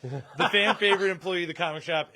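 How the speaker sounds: loud, high mock-grand announcement; sarcastic. Real, not acted. Host retrying a tribute to a coworker, loudly sarcastic. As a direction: hype it up with sarcastic relish. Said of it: "saying it in a sarcastic voice".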